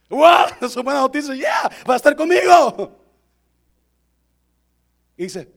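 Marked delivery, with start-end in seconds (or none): 0.1-3.0 loud, high, hammed-up delight; exaggerated joyful yell; mock excitement. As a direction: shout it like wonderful news, half laughing. Acts delighted reply about dying.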